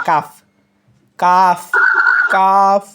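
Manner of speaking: কাফ said light and thin at the front. Shown as a demonstration. Teacher demonstrating the thin-voiced কাফ. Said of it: "এটা হবে পাতলা আওয়াজে".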